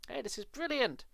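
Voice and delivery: high, delighted exclamation; gleeful cry. Acted. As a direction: crow over the prank. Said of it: "Zack cried".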